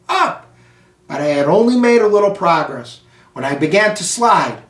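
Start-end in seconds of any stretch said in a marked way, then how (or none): none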